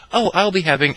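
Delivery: high, loud imitation of a friend; friend ordering. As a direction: put on her loud ordering voice. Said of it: "My friend says".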